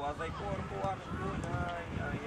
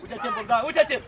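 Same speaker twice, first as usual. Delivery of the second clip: sharp warning shout; 2 semitones higher, 12 dB louder than usual. Real, not acted. Telling Bogdan to look, loud building shout. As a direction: shout to make the player look. Calling to a player.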